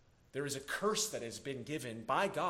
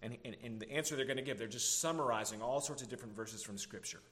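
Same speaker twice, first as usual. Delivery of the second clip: ordinary delivery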